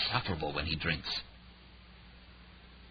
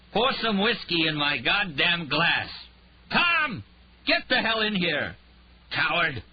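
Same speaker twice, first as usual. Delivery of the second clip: loud, much higher, slow ranting; drunken tirade; 10 semitones higher, 4 dB louder, slower than usual. Acted. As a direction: yell for the whiskey, then scream for Tom. Drunk Rhyme raging at Tom.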